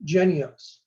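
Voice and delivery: "Jynneos" said as JEN-ee-ohs; pronunciation shown. Shown as a demonstration. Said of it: "but pronounced".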